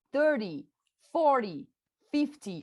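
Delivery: thirty, forty, fifty, each punched up front and falling away on ty; stress on first syllable. Shown as a demonstration. Teacher contrasting with the teens, thirty, forty, fifty stressed on the first syllable. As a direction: hit the start of each tens word. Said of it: "Põe a força na bolinha".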